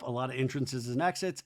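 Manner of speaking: normal talking voice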